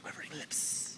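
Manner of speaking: dramatic whispered echo; whispered, theatrical aside; hushed whisper. Retold or imitated. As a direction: drop to a soft, breathy whisper for effect. Dramatic echo of quivering lips.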